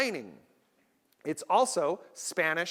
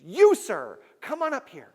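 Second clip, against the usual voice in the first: magician calling up; 4 semitones higher, 6 dB louder than usual. Retold or imitated. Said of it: "he goes".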